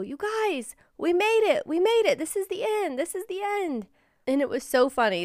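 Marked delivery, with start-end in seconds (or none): 0.0-3.9 much higher, joyful announcement; excited calling. Retold or imitated. Mom calling to her daughters at the finish, excited and high. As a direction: call out the victory to your girls. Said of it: "we turned around and we go".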